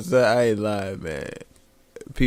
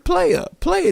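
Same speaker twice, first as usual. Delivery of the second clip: high-pitched protest. Retold or imitated. Quoting himself.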